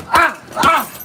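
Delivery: sharp bursts of effort on every strike; punch exertion cries. Real, not acted. Boxer throwing punches in training, effort cries. Punching through a workout round.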